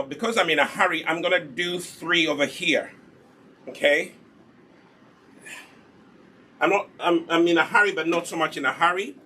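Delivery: ordinary talk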